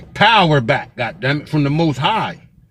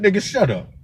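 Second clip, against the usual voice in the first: normal talking voice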